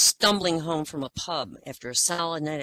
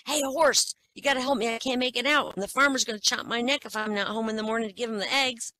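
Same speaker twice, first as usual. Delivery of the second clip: higher, squeaky chicken voice begging for help; pleading chicken; 7 semitones higher than usual. Acted. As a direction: plead like a small panicked chicken. Drunk chicken begging horse.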